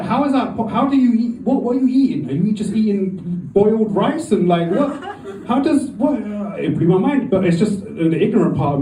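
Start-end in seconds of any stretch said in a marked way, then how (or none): 0.0-6.2 high incredulous questioning; baffled disbelief. Retold or imitated. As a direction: act stunned and confused. Baffled comic quote, high pitch.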